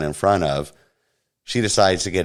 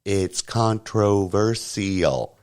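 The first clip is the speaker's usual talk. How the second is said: very slow, over-enunciated mock; sounded out slowly; much slower than usual. Teller mocking the woman's words, slow and over-clear. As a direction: drag out each syllable. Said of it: "why would you sound it out so clearly".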